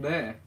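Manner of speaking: bleh with lips and tongue working together; blended R-L sound. Shown as a demonstration. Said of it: "that weird combination".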